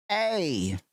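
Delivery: bouncy cartoon pitch for a made-up figure; high put-on hype voice; silly character voice. Character voice bit.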